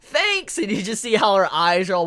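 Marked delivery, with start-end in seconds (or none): 0.0-0.6 squeaky thanks. Retold or imitated. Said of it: "She's like".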